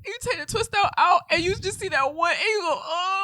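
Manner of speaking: high, loud wail of disappointment; hammed-up hair-disaster lament; mock dismay. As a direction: build to a crying oh. Acting out hair twist-out letdown.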